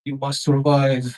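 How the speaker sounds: loud, slow fatherly command; stern Russian dad. Retold or imitated. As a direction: bark it slowly as a survival order. Dad shouting after kicking kid in.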